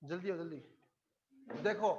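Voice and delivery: ordinary talk, nothing marked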